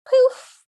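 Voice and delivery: sudden loud, high "poof"; imitated magic poof. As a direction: burst it out like a magic puff.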